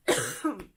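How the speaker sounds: sick, chesty cough; coughing fit. Real, not acted. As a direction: cough hard into the mic. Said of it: "I apologize for the coughing".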